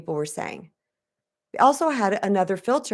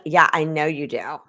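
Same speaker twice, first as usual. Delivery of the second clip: sarcastic version; 4 semitones lower than usual. Shown as a demonstration. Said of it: "that can be like".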